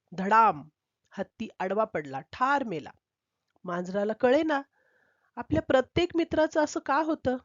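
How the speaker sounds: ordinary delivery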